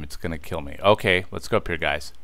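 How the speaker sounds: usual speaking voice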